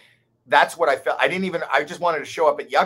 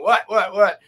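nervous what; 7 semitones higher, 8 dB louder than usual. Retold or imitated. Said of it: "I'm going".

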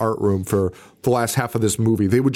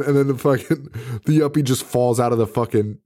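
normal talking voice

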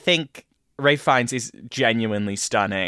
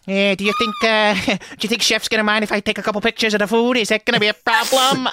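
high, fast, eager cockney-ish chatter; mock the actor as a nervous wannabe; goofy Nicely Nicely impression; 10 semitones higher, faster than usual. Mocking the actor in the film, high and eager. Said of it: "He's like".

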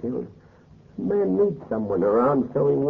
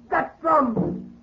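"That drum." cried out very high, with rising panic; "That drum." with the fear breaking loose. Acted. Groom hearing the curse drum again, panicking.

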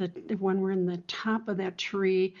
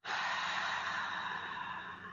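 deep relaxing sigh; 9 dB quieter than usual. Shown as a demonstration. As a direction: breathe out slowly and audibly. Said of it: "take a deep breath".

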